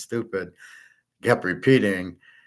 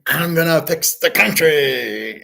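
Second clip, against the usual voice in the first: high, loud, slow campaign-trail shouting; mock politician yelling; 8 semitones higher, 6 dB louder, slower than usual. Mocking a politician yelling at an election rally. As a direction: ham up a ranting politician's promise. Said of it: "they're always yelling".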